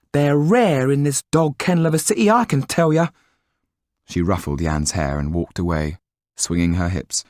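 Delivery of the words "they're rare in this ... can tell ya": much higher, louder landlady banter; wife's voice. Acted. Wife mocking the dog kennel of a city, high banter. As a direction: scoff at the city, cheekily.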